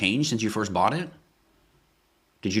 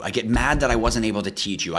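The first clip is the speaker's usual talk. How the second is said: ordinary talk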